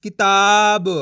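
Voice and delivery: "किताब" loud, syllable by syllable; loud, deliberate model of "किताब"; blended word demo. Shown as a demonstration. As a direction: hold the long vowel in "किताब", sound final "ब" fully. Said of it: "तो बताइए मिलके क्या बनेगा".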